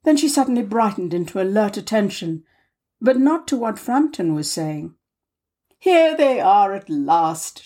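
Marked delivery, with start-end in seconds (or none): 5.8-7.6 slow, eager cry of welcome; calling out, relieved they're back; cried out. Acted. Aunt seeing husband at window, eager cry. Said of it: "she cried".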